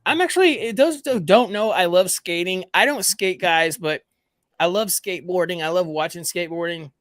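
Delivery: usual speaking voice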